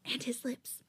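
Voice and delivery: breathy whispered inner thought; whispered aside. A narrator's reading. Listener admiring the singer as he performs, whispered aside. Narrator's aside while reading story.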